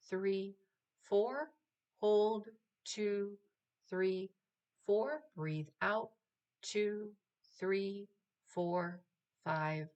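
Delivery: even, rhythmic breath counting, rising on each four; counted breathing guide. Acted. Meditation guide counting breaths for the listener, rhythmic and steady.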